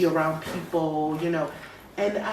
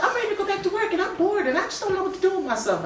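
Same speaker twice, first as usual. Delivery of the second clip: whiny bored friends; 13 semitones higher, much faster than usual. Retold or imitated. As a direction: whine it like a bored friend, laughing. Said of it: "They were like".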